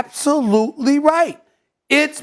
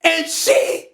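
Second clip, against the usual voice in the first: ordinary talk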